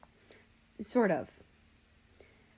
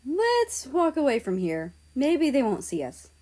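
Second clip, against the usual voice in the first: Poland voice; 6 semitones higher, 4 dB louder than usual. Acted. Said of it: "said Poland".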